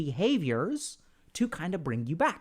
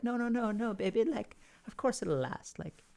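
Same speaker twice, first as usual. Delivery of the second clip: higher, softer, pleading comfort; imitated reassurance; 4 semitones higher, 5 dB quieter than usual. Reassuring a doubting partner, higher and softer pleading. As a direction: coax a worried lover, gently insisting.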